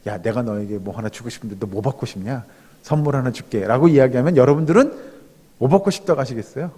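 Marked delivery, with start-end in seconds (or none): 0.1-3.7 low, quiet voice of God; God speaking casually. Acted. God offering Solomon a gift, low and casual. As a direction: play God making a casual offer, voice dropped and soft. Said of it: "하나님께서 솔로몬에게 그런 얘기 하잖아요".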